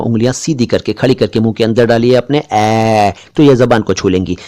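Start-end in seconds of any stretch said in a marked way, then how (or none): 2.4-3.1 "ए" held long as open "ae"; wide-open AI. Shown as a demonstration. Teacher modelling for viewers, long open "ए". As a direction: drop the jaw wide and sustain "ए" as "aeee". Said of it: "ये है ए बोलने का सही तरीका".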